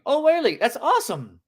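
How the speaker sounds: high, excited leap in pitch; thrilled reaction, swinging right up; upswing tone. Shown as a demonstration. Coach showing a happy reply to rejection, upswing tone. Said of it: "Upswing is a upswing".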